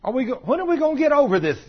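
loud, high-pitched challenge to the congregation; exasperated preaching. Real, not acted. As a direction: demand it with real exasperation. Preacher exhorts against self-pity.

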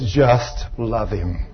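very slow, low and hushed; God speaking softly. Retold or imitated. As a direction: answer gently as God, drawing out each word. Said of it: "He says".

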